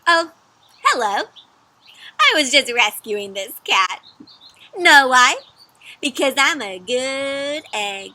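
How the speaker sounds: bright, loud storybook egg voice; cheerful egg character. Acted. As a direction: play the proud little egg.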